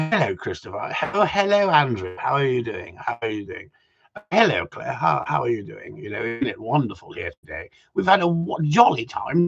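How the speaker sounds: lightly put-on posh English of lords and ladies; posh gentry accent. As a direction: play at chummy upper-class small talk in a posh accent. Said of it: "you start talking like them after a while".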